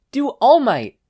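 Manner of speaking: high, loud request; eager demand. Acted. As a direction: call out for the next act. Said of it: "Mikumo demanded".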